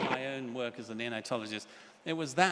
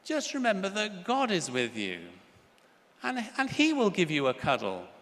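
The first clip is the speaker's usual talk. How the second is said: pious mummy; 7 semitones higher, 5 dB louder than usual. Retold or imitated. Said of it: "because Mummy is very religious, she says".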